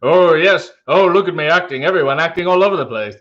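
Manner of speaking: hammed-up actor showing off; Robin Williams impression. Showing off on Actors Studio, mock theatrical voice. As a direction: gush theatrically, a mock thespian performing everywhere. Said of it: "watch the Robin Williams Actors Studio".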